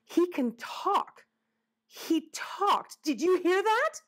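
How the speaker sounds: high-pitched, incredulous amazement; astonished gasp. Acted. Albert to his sister about the talking woodchuck, gasping in amazement. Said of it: "Albert gasped".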